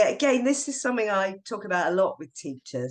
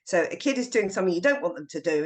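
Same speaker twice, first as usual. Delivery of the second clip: usual speaking voice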